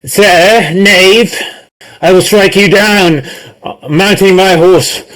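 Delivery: high, grand knightly declaration; heroic knight lines. Acted. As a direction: proclaim it like a gallant knight on stage. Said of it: "gonna say".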